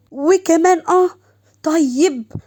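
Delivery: high, loud angry outburst; irritated. A narrator's reading. Jealous Shams reacting to Fazza's teasing yes, irritated. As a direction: explode in indignation, then threaten. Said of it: "شمس بعصبية".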